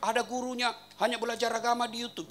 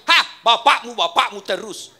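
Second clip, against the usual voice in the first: much louder sing-song whine; sing-song nagging wife; 10 dB louder, slower than usual. Retold or imitated. Wife nags husband over his father, loud sing-song. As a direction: chant the complaint mockingly.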